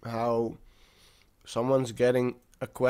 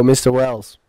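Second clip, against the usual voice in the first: calling out; 10 dB louder, slower than usual. Real, not acted. Calling out to a friend.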